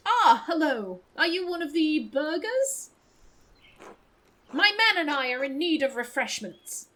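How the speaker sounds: put-on posh accent, lightly played. Traveler asking a townswoman for refreshments, posh voice. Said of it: "I'll give you posh voice".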